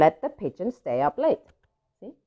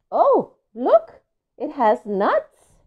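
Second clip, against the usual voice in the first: higher, louder, slow wonder at the nuts; excited; 8 semitones higher, 4 dB louder, slower than usual. Pretend. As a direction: make the kids gasp at the surprise. Reader shows excitement to kids.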